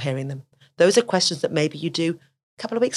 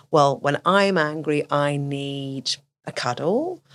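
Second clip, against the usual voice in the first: put-on slow child answer; 2 semitones lower, much slower than usual. Child voiced in example.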